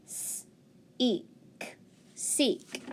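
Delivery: long stretched eek then seek; sounding out seek. Shown as a demonstration. Teacher sounding out seek, long vowel. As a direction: say the long E clearly. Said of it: "long sound".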